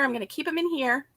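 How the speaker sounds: usual speaking voice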